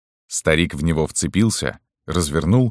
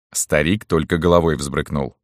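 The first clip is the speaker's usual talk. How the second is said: usual speaking voice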